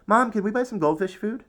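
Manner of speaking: high, bright-eyed asking; loud, high asking mom; boy asking his mother for fish food; eager child. A narrator's reading. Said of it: "I said".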